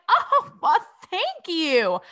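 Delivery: high, sweet gushing thank-you; a pleased, sing-song little thanks; mock gracious thanks. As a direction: accept the flattery like a compliment. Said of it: "I'd be like".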